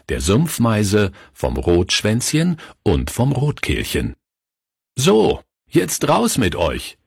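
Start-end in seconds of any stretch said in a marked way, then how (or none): 4.9-7.0 higher, slow-drawn send-off; brisk schoolmaster's dismissal, raised pitch; teacher dismissing class. A narrator's reading. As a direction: call the class outside, a lightly voiced teacher. Teacher sends class outside.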